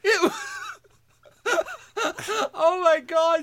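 normal talking voice

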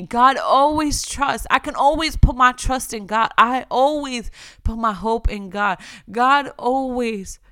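fervent preaching of the verse; preaching at full force. Acted. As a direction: proclaim every line like a pulpit sermon. Reads verse with God for love.

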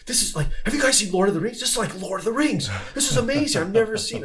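high, fast, gushing voice of a wowed stranger; amazed tourist. Retold or imitated. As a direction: gush like someone who's never seen it. Guy amazed at the shanty singing.

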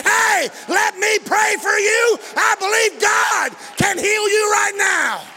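high, loud pulpit shout with stretched words; full-force preaching. Real, not acted.

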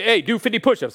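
high, loud call; acted challenge. Preacher challenges someone to do push-ups, loud call. Voices giving a physical challenge.

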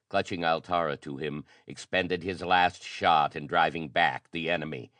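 usual speaking voice

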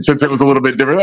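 ordinary delivery

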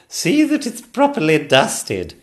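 high, loud, slow regal voice; haughty queen. Acted. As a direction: approve grandly. Queen ordering.

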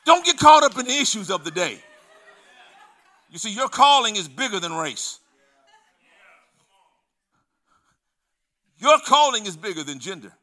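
loud, high, building sermon voice; preaching at full force. Acted. Preacher warning church against online quarrels, loud and high. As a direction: drive it home to the congregation.

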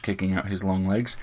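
usual speaking voice

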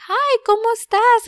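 put-on high, singsong greeting to a baby; baby talk. Brother-in-law saying hello to his little niece, baby talk. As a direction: imitate a grown-up baby-talking in Spanish. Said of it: "just start baby talking her".

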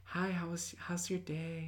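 hushed, high and sweet hello; gentle sweet voice. Retold or imitated. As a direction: coo it like a soft-spoken gentle churchgoer. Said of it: "they talk like this".

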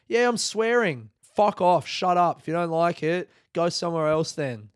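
loud, high-pitched comic outrage; heated, exasperated telling-off; defiant retort. Acted. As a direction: fire back at a scolding, loudly. Acted defiant retort to the women.

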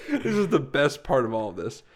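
ordinary talk

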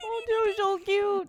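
high, slow, soft cooing. Real, not acted. Cooing over baby chicks.

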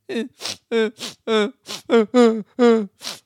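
helpless teary laugh; laughing and crying at once. Real, not acted. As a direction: laugh breaking into a sob. Laughing through tears.

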